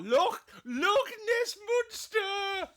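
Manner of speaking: high, loud, drawn-out wail breaking into laughter; hammed-up monster cry. Host echoing co-host's word for L, hammed-up high cry. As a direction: wail it slowly like a mock-dramatic monster announcement.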